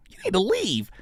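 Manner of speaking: squeaky-high mock order to leave; mock high-pitched dismissal. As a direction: send them out in a put-on high voice.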